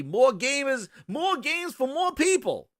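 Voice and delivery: high-pitched mock pitch line; mocking quote. Mimics the other side's claim.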